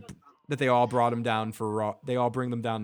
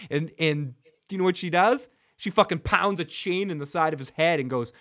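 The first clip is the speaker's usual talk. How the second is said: usual speaking voice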